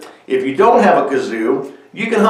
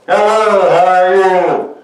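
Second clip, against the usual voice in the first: higher, slow greeting buzzed through a kazoo; 6 semitones higher, 4 dB louder, slower than usual. Shown as a demonstration. Going up to people to say hello, buzzed through a kazoo. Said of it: "And it changes your voice".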